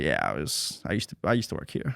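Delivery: a hint of cocky swagger, low and quiet; lightly mock the smug ex-worker; understated smug boast. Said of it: "that, like, cockiness where I'm like".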